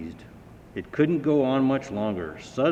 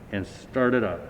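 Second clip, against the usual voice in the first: ordinary delivery, nothing marked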